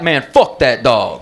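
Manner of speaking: slow, emphatic acted cursing, leaning on FUCK; mock disgust. Dog owner walking off looking at me, slow mock-angry disgust. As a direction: curse the dog off with drawn-out contempt. Owner storms off after fight.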